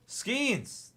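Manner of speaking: high, loud burst of joy; excited shout. Real, not acted. Pulling a card on stream, excited high shout. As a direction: call the name out thrilled. Pulls a favourite player's card.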